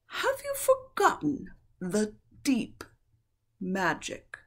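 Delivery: high, slow taunting witch. A narrator's reading. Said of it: "asked the witch".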